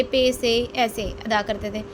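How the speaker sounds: ordinary delivery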